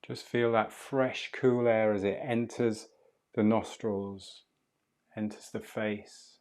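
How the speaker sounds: calm, unhurried meditation guide voice; soothing breathwork guide talking a student through the breath; guided breathing meditation. Acted. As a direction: ease the listener through each breath, gently.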